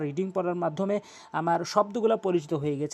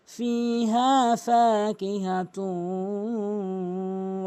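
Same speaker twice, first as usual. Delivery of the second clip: slow, drawn-out chanted recitation; Quran recitation; much slower than usual. Shown as a demonstration. Tajweed teacher reciting the verse for students, slow and melodic. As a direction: recite it melodically, stretching every vowel.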